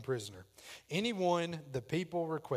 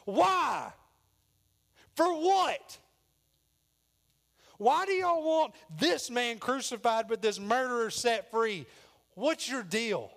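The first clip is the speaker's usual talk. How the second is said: loud, high indignant demand; Pilate's forceful protest; 8 semitones higher, 7 dB louder, slower than usual. Acted. Pilate challenging the crowd's demand, loud and indignant. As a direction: confront the mob, voice raised hard. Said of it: "That's more how I see it".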